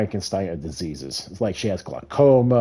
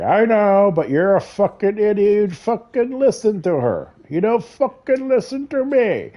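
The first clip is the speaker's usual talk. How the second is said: imitated angry father; 11 semitones higher than usual. Son voicing his father scolding him, high mock rant.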